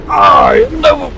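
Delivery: high, slow, shouted villain; Dr. Wheelo voice. Acted. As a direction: bellow it like a mad cyborg. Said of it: "Doctor Wheelo?".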